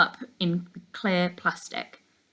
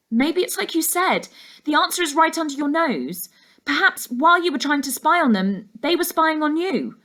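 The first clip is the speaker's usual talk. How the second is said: put-on higher, eager girl's voice; young girl Laura; 9 semitones higher, 4 dB louder than usual. A narrator's reading. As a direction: lift the voice into a keen young girl.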